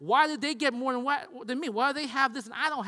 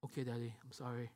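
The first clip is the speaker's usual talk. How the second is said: low, very soft mumbly apology; meek child's apology; 9 semitones lower, 14 dB quieter than usual. Retold or imitated. Son answering dad's telling-off, meek and unbothered. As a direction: drop to a small, subdued sorry. Son's subdued reply to dad.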